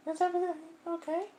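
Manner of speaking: a sweet high voice full of concern; high girlish concerned voice; Sayori's voice. Acted. Voicing Sayori.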